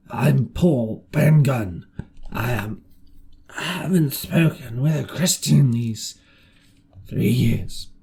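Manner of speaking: slow, hoarse castaway voice, rough from disuse; a rusty, awkward marooned man. Acted. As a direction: speak like a voice unused for years. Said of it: "his voice sounded hoarse and awkward, with a rusty lock".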